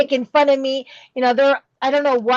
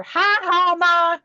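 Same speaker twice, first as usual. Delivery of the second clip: higher, mock-teasing son laughing at mom; son's teasing laugh; 7 semitones higher, slower than usual. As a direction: tease her like a cheeky son. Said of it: "I'd hear".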